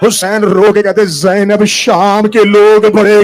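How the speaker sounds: loud, slow grieving cry rising into sung shout; preaching at full force. Acted. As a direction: wail it out to the mourners. Builds into loud wailing recital. (بلند آواز میں گریہ آمیز بین)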